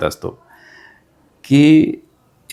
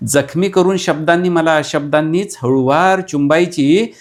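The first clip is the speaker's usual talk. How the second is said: dramatic repeat; 6 semitones higher, 3 dB louder than usual. Acted. Repeated line in full voice.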